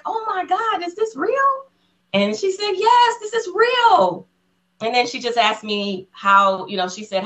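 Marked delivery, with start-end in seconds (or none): 0.0-1.5 high-pitched excited gasp of a question; squeaky, amazed retelling; starstruck disbelief. Retold or imitated. Starstruck fans meet their idol. 2.7-4.3 slow, very high squealed confirmation; high, drawn-out playful exclamation; mock excited screaming. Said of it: "So she started screaming".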